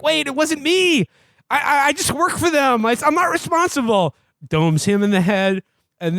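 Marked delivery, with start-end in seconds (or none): 0.0-4.1 high, squeaky stammering plea; hammed-up cowardly whimper; mock panicked begging. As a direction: play a terrified henchman begging for his life. Lackey begging after being shot.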